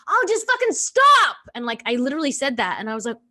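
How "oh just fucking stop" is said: much higher, louder, slow angry outburst; snapping at kid. Retold or imitated. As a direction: lose your temper at your child.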